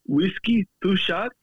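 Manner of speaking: high-pitched mock Indian accent ordering whiskey; hammed-up Indian uncle asking for two shots; imitating Indian passenger. Impersonating Indian passengers ordering whiskey from the crew, in a high mock Indian accent. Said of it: "every Indian man was like".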